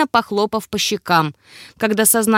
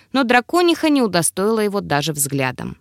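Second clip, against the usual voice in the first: plain reading voice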